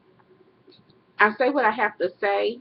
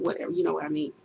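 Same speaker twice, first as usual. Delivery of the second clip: ordinary delivery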